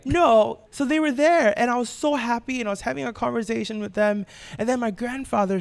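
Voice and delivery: holding back tears and pressing on; teary-eyed. Real, not acted. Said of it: "You're getting teary-eyed".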